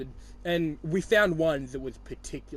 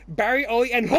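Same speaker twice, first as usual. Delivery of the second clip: imitated turkey gobble, louder and higher; turkey noise; 6 semitones higher, 10 dB louder than usual. Imitating friend who couldn't read Phosphor, turkey gobble. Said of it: "turkey noises".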